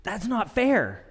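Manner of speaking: high, slow mock complaint; drawn-out imitation of a child's objection; imitating daughter's protest. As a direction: protest like an indignant kid. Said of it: "in the words of my daughter".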